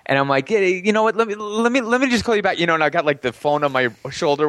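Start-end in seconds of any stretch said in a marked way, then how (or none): 0.5-2.6 high-pitched, stammered excuse; distracted brush-off. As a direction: imitate fobbing her off on the phone. Brushing off girlfriend while gaming.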